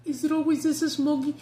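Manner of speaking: a mock movie voice asking about the smog; movie line quote. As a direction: toss off the movie line brightly. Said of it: "I would say".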